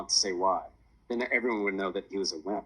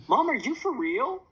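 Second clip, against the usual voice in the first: high, drawn-out whine; whining; 4 dB louder than usual. A narrator's reading. Daughter protesting Mom collecting the bet money, whining. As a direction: whine in disbelief, stretching the words. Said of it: "Sophia whined".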